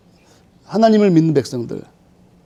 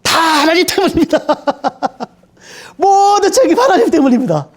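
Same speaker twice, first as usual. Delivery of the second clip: higher, laughing plea; laughing, blaming God; 9 semitones higher than usual. Acted. Spirit-filled believer handing every burden to God, laughing and high. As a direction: say it through a laugh. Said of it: "진짜 하나님의 성령을 받은 사람은 뭐예요".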